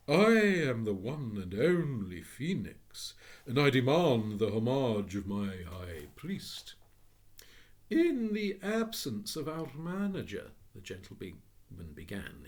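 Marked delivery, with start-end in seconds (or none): 0.1-6.8 louder, slow, grand demand; a bird god to its priest; commanding phoenix. Acted. 7.9-10.5 higher, slow courteous voice; polite clerk. Acted. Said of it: "as though he were addressing a valued customer".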